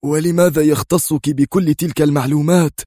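high, heated challenge; شامبليون. A narrator's reading. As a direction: demand it accusingly. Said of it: "هتف شامبليون". (باعتراض حاد)

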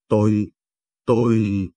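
a hesitant, stumbling start; stammering. A narrator's reading. Mother-in-law stammers when asked where she was, faltering. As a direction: catch on the first word and falter. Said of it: "ban đầu còn ấp úng".

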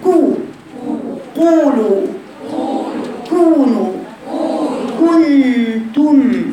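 high, slow, lips rounded on قولوا كونوا كنتم; full damma. Shown as a demonstration. Teacher drills words with a complete damma. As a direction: stretch each damma with pursed lips. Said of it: "شايفة ضمة الشفتين إزاي".

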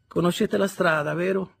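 high, loud spinster voice full of spite; a jealous relative sniping politely; sour Sarah Pocket. Acted. Sarah Pocket greets Pip at the corridor's end, sour and prim.

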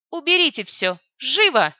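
loud snapping order; sharp shout. Acted. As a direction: bark the order at the soldiers. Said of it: "прикрикнула сестра Сокола". (резкий громкий окрик)

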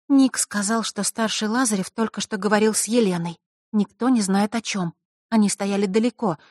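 high girl's voice, a mock whisper fully voiced; confiding girl. A narrator's reading. Diana leans over the table to tell friends, high confiding voice. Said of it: "и прошептала".

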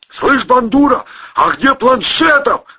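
high, loud, puffing and snorting; panting while swimming. Acted. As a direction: call out between breaths. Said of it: "фыркая, отдуваясь".

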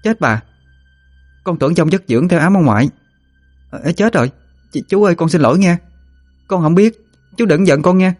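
put-on high-pitched, startled girl apologising; flustered apology. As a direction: apologise anxiously for hitting the wrong one. (giọng cô gái cao, rối rít xin lỗi)

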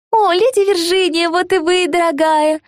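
high chirping voice; cartoon princess. A narrator's reading. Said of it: "прощебетала Эмбер".

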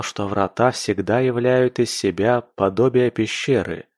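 ordinary delivery, nothing marked

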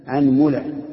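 أنملة as "anmula", a first, u on the meem; teacher's clear demo of أنملة with middle u; a then u. Shown as a demonstration. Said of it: "ضمه مع فتحها".

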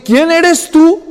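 put-on high, slow, chilled question from an old blind man; startled old father. Isaac startled as his son brings the meal, high and slow. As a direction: ask it with the blood running cold. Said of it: "se le hiela la sangre a Isaac".

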